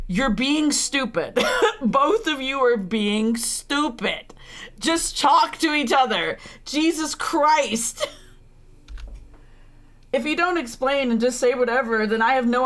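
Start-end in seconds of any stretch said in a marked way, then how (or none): 0.1-8.1 slow, drawn-out words in sheer exasperation; exasperated scolding. Real, not acted. Streamer telling both characters to just talk, exasperated. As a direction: stretch each word, fed up and half laughing.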